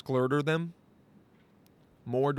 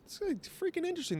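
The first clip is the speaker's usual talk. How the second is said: excited; 5 dB quieter than usual. Real, not acted. Excited about Viking trivia.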